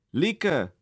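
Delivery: teacher startling the rabbit; sudden roar. A narrator's reading. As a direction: bark his name without warning. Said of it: "răgnește ea pe neprins de veste".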